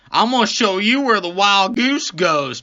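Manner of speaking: high, loud hammed-up cop threat; mock menacing threat. Cop snapping on gloves at the driver, loud high mock threat. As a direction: threaten him loudly in an imitated swagger. Acts cop's menace while gloving up.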